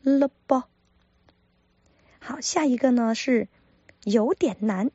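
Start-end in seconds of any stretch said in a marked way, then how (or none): 0.0-0.6 咯 tossed off lightly, 波 louder; barely touch 咯, hit 波; stress on 波. Shown as a demonstration. Teacher stressing the second syllable 波, louder. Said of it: "前面咯是轻轻的带过".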